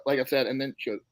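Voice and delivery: usual speaking voice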